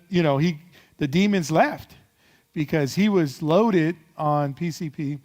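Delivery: usual speaking voice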